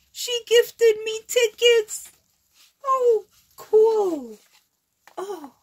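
very high, loud squeal; giddy, piercing delight. Real, not acted. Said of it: "I'm just so, like, a kid".

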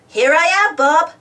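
announcing his arrival; cried out. A narrator's reading. Muck roaring back into the yard, calling to Bob. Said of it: "cried Muck".

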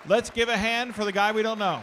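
loud, rousing call to the crowd; big announcing shout to the congregation; calling for applause. Real, not acted. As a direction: whip up applause like a host. Calls congregation to applaud volunteer.

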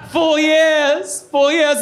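loud wailing soap diva; grand tragic wailing. Acted. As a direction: wail it like a tragic heroine. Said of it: "in the voice of Joan Collins from Dynasty".

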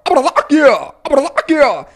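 "verdad" snapped into a squawking DJ scratch; vocal record scratch. Shown as a demonstration. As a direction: choke the end of "ah, verdad" into a squeal. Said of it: "meter más la voz por acá".

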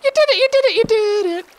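high, loud squealing cheer; excited cheering. Real, not acted. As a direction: cheer them on, thrilled. Cheering friend's diamond find.

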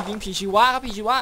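excited shout. Real, not acted. Gamer spotting zombies, fast excited shout. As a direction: blurt out the threat quickly.

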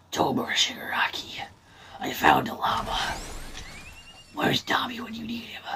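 breathy stage whisper, high and secretive. Pretend. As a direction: whisper like sneaking up on someone. Whispering in-game, far timbre.

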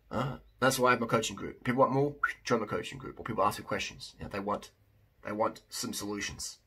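ordinary delivery

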